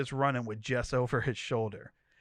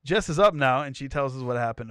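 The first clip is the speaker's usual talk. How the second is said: punchier, louder retake of the line; 8 dB louder, faster than usual. Shown as a demonstration. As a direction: say it again with more force. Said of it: "She just woke up".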